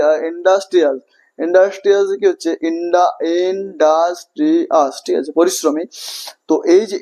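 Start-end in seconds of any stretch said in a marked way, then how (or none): 2.7-4.9 ইন্ডাস্ট্রিয়াস broken slowly into in-das-tri-as; syllable by syllable. Shown as a demonstration. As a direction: separate each syllable of ইন্ডাস্ট্রিয়াস. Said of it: "এতবার ভেঙে ভেঙে বলতে হচ্ছে".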